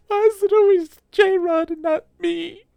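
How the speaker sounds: high, loud, put-on whiny complaint; mock jealous whine. Streamer jokingly whines about being left out, high mock whine. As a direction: pretend to be hurt, whining way up high. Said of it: "I'm kidding".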